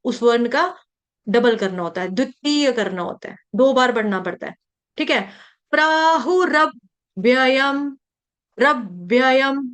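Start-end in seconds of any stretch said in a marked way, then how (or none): 5.7-9.7 slow प्राहुरव्ययम with doubled consonant and half व; careful pronunciation demo. Shown as a demonstration. Teacher telling students watch my mouth, slow precise pronunciation. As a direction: split रव्ययम as rav-vyayam, slowly. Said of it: "देखो मेरा मुंह".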